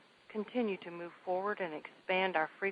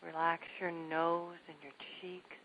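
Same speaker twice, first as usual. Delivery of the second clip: guided meditation; 6 dB quieter than usual. Acted. Host leading listeners in staged relaxation, calm soft voice. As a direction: guide them gently through the body.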